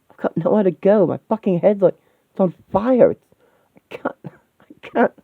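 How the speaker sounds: high, agitated, panicked ranting; desperate breakdown. Acted. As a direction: unravel until the words give out. Distraught character breaks down.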